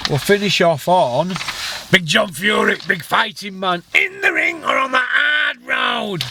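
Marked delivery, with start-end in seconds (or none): 1.9-6.2 mock ring announcer. Vlogger mockingly introduces Big John Fury, ring announcer shout.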